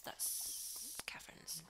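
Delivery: slow whisper. Real, not acted. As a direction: whisper the answer.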